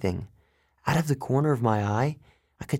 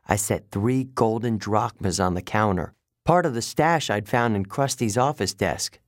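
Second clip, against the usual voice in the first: ordinary delivery, nothing marked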